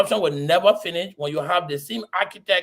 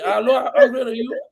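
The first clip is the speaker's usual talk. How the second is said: mock reply; 3 semitones higher, 4 dB louder than usual. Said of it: "putting your mouth on Paul Kennedy".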